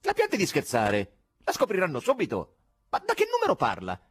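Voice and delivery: high, heated phone demand; angry outburst. A narrator's reading. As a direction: snap back at the anonymous caller. Exploding at an anonymous caller. (sfuriata acuta al telefono)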